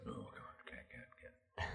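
whispered coy refusal; breathy whispered can't, can't; teasing whisper. Retold or imitated. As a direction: hush it like a tease keeping a secret. Voicing director teasing about script.

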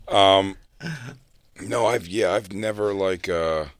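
normal talking voice

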